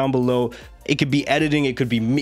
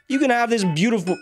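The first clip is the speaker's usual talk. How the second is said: hammed-up high praise; 10 semitones higher than usual.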